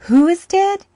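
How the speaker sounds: high, loud sharp question; jolted, demanding; startled. Acted. Uncle startled by the boy's news, sharply asking.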